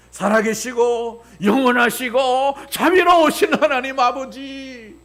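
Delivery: high, loud, slow solemn prayer opening; formal prayer. Retold or imitated. Pastor mocking ornate prayer a new believer need not use. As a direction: intone like a pompous prayer. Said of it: "이런 말이 필요 없는 거예요".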